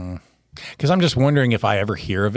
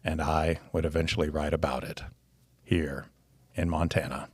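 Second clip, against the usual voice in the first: put-on low, quiet, solemn old author; Norman McLean voice; 6 semitones lower, 9 dB quieter than usual. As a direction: read it gravely, fading to a whisper. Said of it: "as we think Norman McLean would sound".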